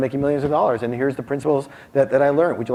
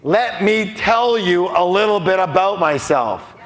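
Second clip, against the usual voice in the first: slow, higher mock of a boring presenter; a hint of a tedious speaker; mock drawn-out opener; 7 semitones higher, 4 dB louder, slower than usual. Shown as a demonstration. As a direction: drag "Let me tell you a little bit about myself" out to mock it. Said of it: "The last thing you wanna do is say".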